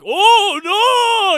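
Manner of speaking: high, very loud mock panic; mock scream. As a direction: wail it in hammed-up terror. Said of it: "like, she's screaming".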